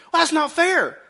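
put-on high, loud childish whine; whiny child. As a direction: whine like a kid denied ice cream. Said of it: "our kids".

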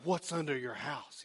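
slow, soft horror-trailer whisper-like read; hushed eerie movie title; spooky movie voice. Pretend. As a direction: creep the listener out slowly. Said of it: "sounds like one of these movies".

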